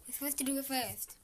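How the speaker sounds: ordinary delivery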